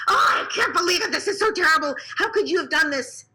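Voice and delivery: a crying parent's shout of dismay; upset scream. Retold or imitated. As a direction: act out a parent's distraught outcry. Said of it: "even if they just scream".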